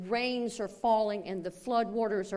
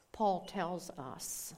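normal talking voice

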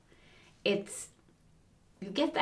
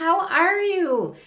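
much higher, louder, slow sugary greeting; gushing welcome; 11 semitones higher, 8 dB louder, slower than usual. Retold or imitated. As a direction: stretch it out like an over-sweet hostess. Mom switches to sweet greeting.

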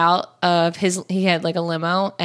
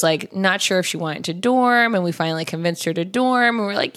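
usual speaking voice

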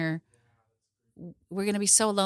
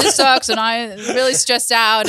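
much louder, hammed-up guy voice with a laugh; mock man voice; 2 semitones higher, 9 dB louder than usual. Shown as a demonstration. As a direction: put on a big manly voice, half laughing. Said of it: "let me go with my voice".

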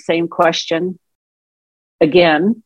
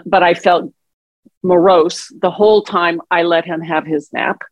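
ordinary talk

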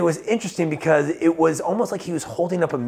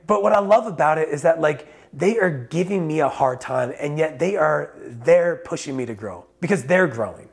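ordinary talk

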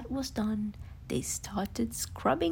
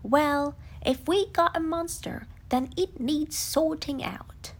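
louder, no-nonsense voice; declaring Rabbit; 4 semitones higher, 6 dB louder than usual. Acted. Rabbit briskly declares he'll sort out the monster. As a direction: take charge of the problem. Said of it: "Rabbit declared".

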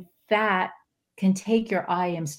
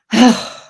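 loud frustrated sigh; 4 semitones higher, 11 dB louder than usual. Retold or imitated. Whiny complaint sigh.

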